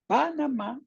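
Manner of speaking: "Panamá" pitched high, early syllables brought out; stress shifted in Panamá. Shown as a demonstration. Teacher offering students a choice, "Panamá" with high trial stress. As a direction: say "Panamá" high, as a quiz option. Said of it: "la respuesta correcta sería".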